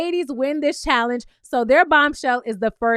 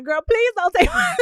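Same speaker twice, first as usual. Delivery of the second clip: mock plea breaking into laughter; 9 semitones higher than usual. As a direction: imitate Hannah's plea, laughing. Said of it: "Hannah in her confessional".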